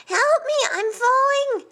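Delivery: high-pitched shout for help; panicked cry. A narrator's reading. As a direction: cry out in mid-fall. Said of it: "he cried".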